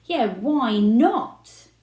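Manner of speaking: loud, slow, drawn-out challenge; uncontrolled temper. A narrator's reading. Girlfriend backing up dad, loud and slow. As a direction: snap it back, letting the temper fly. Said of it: "definitely not controlling her temper".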